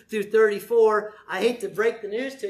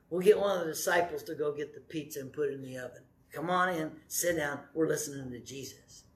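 much softer, coaxing tone to a wife; gentle coaxing; 5 semitones lower, 6 dB quieter than usual. Pretend. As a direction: softly call her away from the kitchen.

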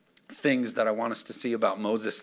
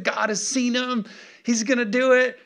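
high-pitched, stretched-out elation; Moses, thrilled; 9 semitones higher, 4 dB louder than usual. Acted. Moses rejoicing that God saw the oppression, acted out elated. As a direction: squeal it like overjoyed good news. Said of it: "He's so excited".

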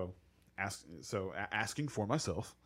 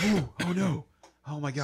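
dismayed; 6 semitones higher, 8 dB louder than usual. Real, not acted. Hearing player not expected to play.

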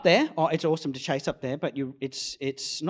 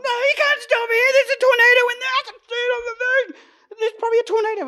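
much higher and louder hammed-up excitement; mock excited insistence; 12 dB louder, faster than usual. Storm chaser telling his partner he won't stop, excited mock shout.

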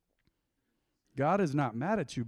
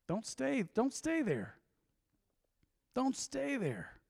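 higher and softer plea, slowed at the end; pleading; 8 semitones higher, 6 dB quieter, much slower than usual. Real, not acted. Preacher pleading with sinners to leave, soft and high. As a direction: beg them gently not to stay.

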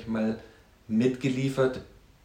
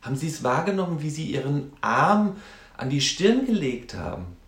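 higher-pitched, quick counselling question; counsellor to client; 6 semitones higher than usual. Retold or imitated. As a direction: draw a client's attention to a gesture.

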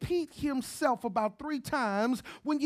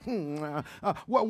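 ordinary delivery

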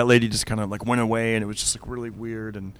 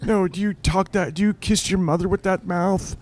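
higher, gasping mock outrage; shocked prim lady; 7 semitones higher than usual. Teller mimics the shocked lady, higher and prim. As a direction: play her prim shock, pitched up.

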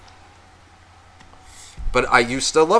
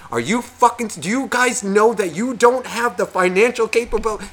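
high, incredulous acted rant; indignant scolding; 9 semitones higher than usual. Relative to the new parents about money, indignant. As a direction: scold the irresponsible parents, pitch raised.

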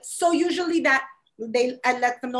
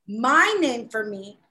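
ordinary delivery

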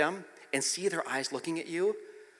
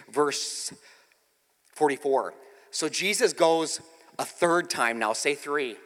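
ordinary talk

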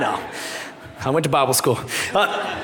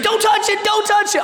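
put-on panicked child; 9 semitones higher than usual. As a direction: beg the dad not to touch it. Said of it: "And he's like".

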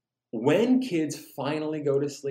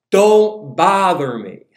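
loud, drawn-out higher-pitched holler; yelled refusal; 4 semitones higher, 10 dB louder, much slower than usual. Acted. Grumpy neighbor to midnight knocker, yelled. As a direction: yell it slowly through the door. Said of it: "yells out".

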